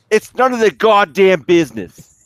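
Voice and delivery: loud slow gruff dad voice. Retold or imitated. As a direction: bark it like an annoyed dad. Dad quoted in radio story.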